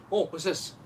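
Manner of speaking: normal talking voice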